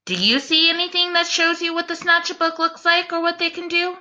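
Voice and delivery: normal talking voice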